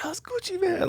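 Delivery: high squealing fan voice; mock high-pitched freakout; starstruck squeal.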